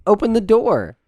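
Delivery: loud, high, insistent demand; frustrated young master raising their voice; exasperated shouting. Acted. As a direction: order the servant about, fed up. Young master orders servant to open up.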